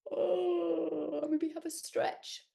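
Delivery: yawning through the words; sleepy yawn. Shown as a demonstration. Storyteller showing a sleepy yawn, yawning. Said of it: "Can you do a sleepy yawn?".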